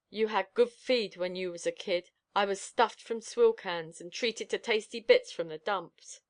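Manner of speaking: ordinary delivery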